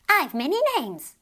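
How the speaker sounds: lightly voiced fairy child; mischievous fairy child. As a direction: tease like a tiny mischievous fairy.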